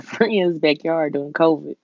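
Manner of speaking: ordinary talk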